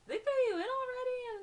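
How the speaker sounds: high, loud disbelief; re-enacted astonished outburst; shocked question. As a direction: blurt it out in amazement. Retold shocked question to Ebony.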